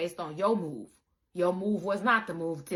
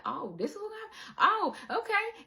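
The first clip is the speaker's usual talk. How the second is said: mock surprise; 12 semitones higher than usual. Mock reaction of listener.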